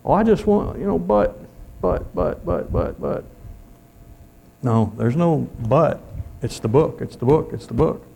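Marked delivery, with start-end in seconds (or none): 0.0-3.2 high, whining mock churchgoer stammering but, but; whiny excuse. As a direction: mimic a squirming excuse-maker, pitched up.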